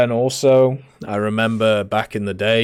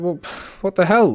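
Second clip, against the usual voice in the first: sigh, then high and incredulous; retelling his reply to the user, high and exasperated; exasperated disbelief; 10 semitones higher, 4 dB louder than usual. Retold or imitated. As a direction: throw up your hands at it. Retold reaction to payment demand.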